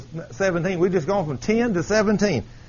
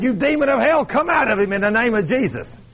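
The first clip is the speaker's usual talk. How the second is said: higher, forceful casting-out order; bold exorcism command; 5 semitones higher than usual. Acted. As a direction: command the demon out with authority. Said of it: "if somebody got up and said".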